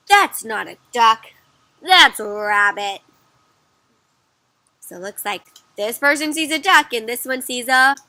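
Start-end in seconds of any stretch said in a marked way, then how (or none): 0.1-3.0 slow, loud storybook correction; emphatic disagreement, drawn out; firm contradiction. Acted. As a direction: set them straight, word by word. Second character contradicts the first.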